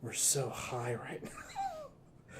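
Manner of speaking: slow stoner voice, half laughing. Pretend. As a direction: drag it out, dazed and giggly. Stoner line repeated, drawn out.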